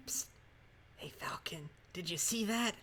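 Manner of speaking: hushed conspiratorial whisper. Acted. Sidekick whispering to Falcon about something seen, whisper. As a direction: whisper to get a partner's attention on the sly. Sidekick secretly calls the detective.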